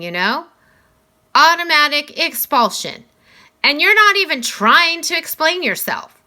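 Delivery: a principal gently laying down the rules; Mister Tushman. Acted. Voices Mr. Tushman.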